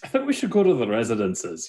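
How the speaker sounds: lightly voiced character, high at first; Dahlin. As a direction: start high in character, then settle down. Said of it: "Dahlin kinda stands there and he goes".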